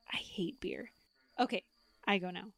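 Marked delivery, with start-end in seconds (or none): 0.1-0.9 hushed whisper. Real, not acted. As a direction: whisper it under your breath. Whispered confession in drinking game.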